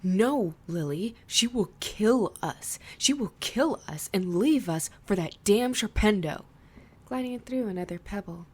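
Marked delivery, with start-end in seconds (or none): 0.0-6.4 loud, sharp protest; sharp angry outburst. Acted. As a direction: snap back at her, hard and loud. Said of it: "his voice sharp".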